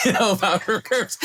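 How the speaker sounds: words breaking into laughter; laughing. Retold or imitated. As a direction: laugh out the punchline. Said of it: "So I'm laughing".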